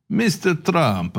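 slow mock Russian accent, a bit Borat; slow, hammed-up Russian accent. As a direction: drawl Mister Trump like a Russian official. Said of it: "I don't know where this accent is coming from".

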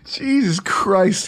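breathless whisper through laughter; after laughing hard. Real, not acted. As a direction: wheeze it out, still cracking up. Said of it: "let me compose myself here".

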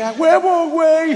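bursting with pride, shouting; proud shout. Retold or imitated. Proudly shouting A huevo at Manolo. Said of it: "Que dije".

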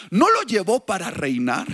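ordinary talk

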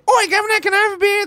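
very high-pitched mock British accent; Oi Governor act. American ordering at a London bar, high hammy British accent. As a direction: squawk it as a hammed-up Londoner. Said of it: "do that Oi Governor act of yours".

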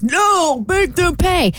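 high, petulant imitation of objectors; indignant mock quote. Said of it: "those people ... was like".

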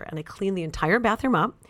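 ordinary talk

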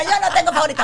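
high, loud laughing denial; laughing protest. Retold or imitated. Mother retelling her own denial to daughter, laughing high and loud. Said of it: "Y yo".

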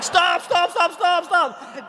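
high, loud, repeated cries; panicked pleading. Retold or imitated. Friend trapped in tipping porta potty, imitated panicked pleading. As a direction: plead frantically to be let alone.

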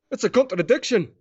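high-pitched comic Scottish ad character; Skittles guy. Acted. Host quotes an advert character, high comic Scottish voice. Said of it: "kinda like the Skittles guy".

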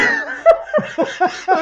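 squealing burst of laughter; high laugh. Real, not acted. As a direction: let out a real high laugh. Laughs hard at memory.

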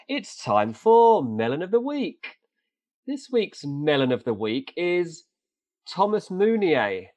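slow, high, drawn-out announcement; mock-ceremonial award presenter; segment announcer. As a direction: stretch it out like a grand reveal.

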